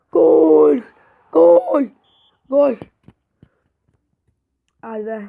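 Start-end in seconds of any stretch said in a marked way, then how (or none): 0.1-2.8 high, loud goal yell; goal shout. Real, not acted. Gamer scoring again, loud high goal yell. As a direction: belt out the goal.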